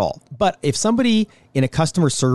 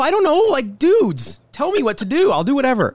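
high-pitched, helpless protest; hammed-up helpless exasperation; mock exasperation; 10 semitones higher than usual. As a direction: act out a customer at a loss, pitched way up. Acts out helpless customer at register.

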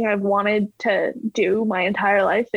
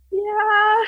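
imitating herself; 11 semitones higher than usual. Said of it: "And I'm like".

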